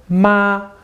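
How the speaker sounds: "Ma" held high and level; first-tone "Ma", a flat high line; high steady first tone. Shown as a demonstration. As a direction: say "Ma" on one high, straight pitch. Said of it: "your voice is high and steady".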